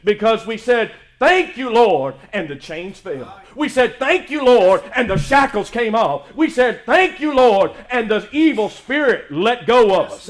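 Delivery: loud rhythmic preaching, punching each thank you; soaring sermon delivery; full-force preaching. Acted. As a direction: preach it out to the congregation. Preacher's climax on thanking God.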